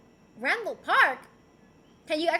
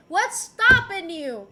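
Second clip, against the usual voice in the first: teasing challenge; 3 semitones higher, 9 dB louder, slower than usual. Pretend.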